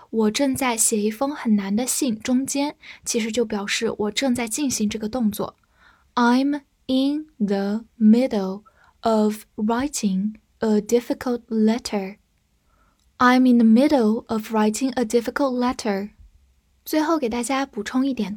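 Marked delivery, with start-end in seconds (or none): 6.2-12.2 unhurried, careful model reading; slow reading. Shown as a demonstration. Teacher reading the sentence slowly to the class. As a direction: read it out slowly, word by word. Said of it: "slow reading for students".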